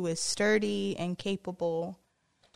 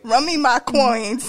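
loud, slow, higher-pitched demand; mock demand; 5 semitones higher, 10 dB louder, slower than usual. Host demands payment from imagined copycats, mock demanding. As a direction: draw it out loudly, playfully demanding.

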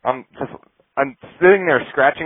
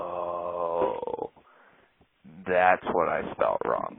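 long, low, soft groaned "oh"; slow, deflated and quiet, as it sinks in; dawning realization; 4 semitones lower, 9 dB quieter, much slower than usual. Retold or imitated. Pilot finally seeing what he spelled wrong, sinking realization. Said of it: "you just get that moment of".

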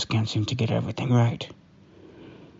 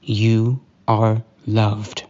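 tender reassurance; much slower than usual. Acted. Comforting a distressed loved one.

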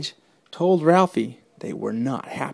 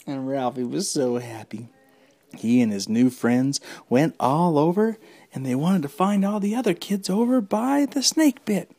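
ordinary delivery, nothing marked

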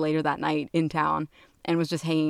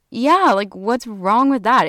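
indignant retort; 5 semitones higher, 6 dB louder, slower than usual. Retold or imitated. Retorting to nosy relatives.

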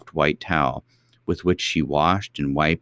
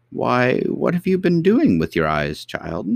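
lightly voiced kindly old lady, raised pitch; gentle grandmother asking, higher; 7 semitones higher, 4 dB louder than usual. A narrator's reading. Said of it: "asked the old lady".

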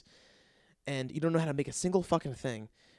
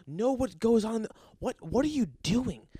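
loud, high, furious telling-off; angry rant; 6 semitones higher, 4 dB louder than usual. Acted. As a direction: tear into the new guy like a fed-up bartender. Ranting at the new guy.

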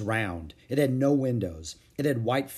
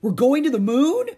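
high, loud disbelieving question; astonished gasp; 14 semitones higher, 9 dB louder than usual. A narrator's reading. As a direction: blurt it in amazement, rising at the end. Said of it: "Annie gasped".

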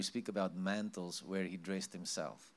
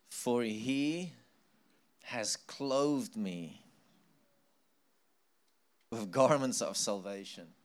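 louder, higher ringing scripture read; exultant proclamation; 3 semitones higher, 8 dB louder than usual. Acted. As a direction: cry it out in praise. Rereads verse louder, exultant.

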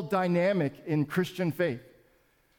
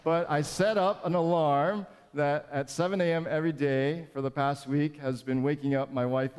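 ordinary delivery

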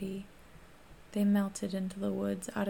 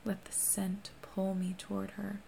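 ordinary delivery, nothing marked

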